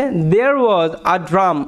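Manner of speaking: very slow, word by word; slow teacher dictation; slow, clear read. Shown as a demonstration. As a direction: read each word slowly for the students. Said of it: "দেখো কি আছে".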